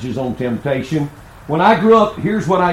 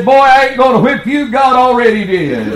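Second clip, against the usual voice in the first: put-on higher and louder, a laughing father letting his boy off; father's amused verdict; 8 semitones higher, 7 dB louder than usual. Father to his sick son, loud and amused. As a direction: play the dad, amused, booming the punchline. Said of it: "He said".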